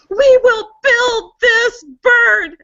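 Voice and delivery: high, loud, drawn-out rallying cry; belted movie-heroine proclamation; princess's shout. Acted. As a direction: shout it slowly, punching every word like a movie princess. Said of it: "when the princess stands up and she shouts".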